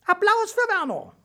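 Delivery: loud, very high squeaky announcer; announcing mouse. A narrator's reading. Little mouse referee calls applause for Werner, excited squeak. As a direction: squeak it out to the crowd.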